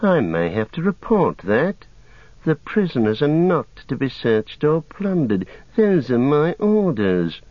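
sneering evil voice. A narrator's reading. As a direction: threaten smoothly, with disdain. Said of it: "sneered a voice softer than the others but more evil".

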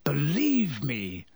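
slow, drawn-out croak. A narrator's reading. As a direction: croak it out slowly.

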